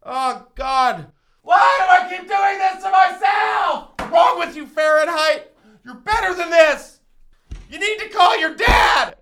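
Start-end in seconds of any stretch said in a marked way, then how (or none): 1.4-9.2 anguished rant. Acted. Pepper agony ranting.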